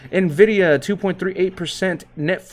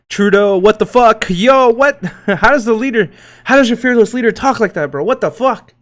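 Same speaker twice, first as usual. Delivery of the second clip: loud, higher, incredulous yelling at the screen; outraged disbelief; 5 semitones higher, 7 dB louder than usual. Real, not acted. Streamer reacting loudly to a Trudeau clip. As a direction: shout in real disbelief.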